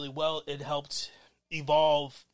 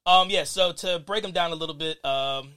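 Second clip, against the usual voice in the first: normal talking voice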